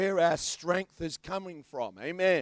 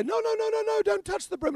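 high-pitched mock shopkeeper pleading; much higher, fussy no no no; shopkeeper protest; 14 semitones higher than usual. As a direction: squeak it up like a flustered shopkeeper.

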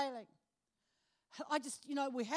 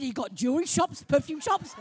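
much louder, delighted question; gleeful loud question; 12 dB louder than usual. Retold or imitated. Woman asking the cruise line about shops, loud and gleeful. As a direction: call it out with greedy glee.

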